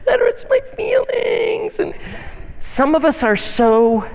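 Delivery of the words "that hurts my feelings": milk the little wound for pity; overdone wounded wail; self-pitying whine. Retold or imitated. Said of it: "and it's like".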